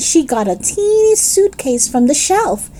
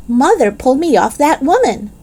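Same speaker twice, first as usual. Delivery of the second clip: ordinary delivery, nothing marked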